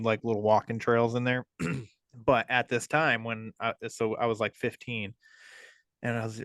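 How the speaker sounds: normal talking voice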